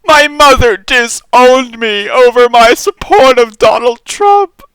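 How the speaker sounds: high, slow, loud mock sincerity; mock pious reply guy. Mocking a Trump fan's sob story, high and pious. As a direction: whimper it like a martyr asking for prayers. Reading the reply guy's post.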